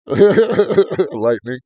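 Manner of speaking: laughing through the word. Real, not acted. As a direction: burst out laughing as you correct. Laughing correction.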